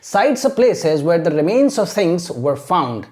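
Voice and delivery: very slow, careful English sentence; teacher's deliberate word-by-word read-out; model reading. Shown as a demonstration. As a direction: read it out slowly as one clean sentence for learners. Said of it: "अब इसको कैसे पढ़ते हैं".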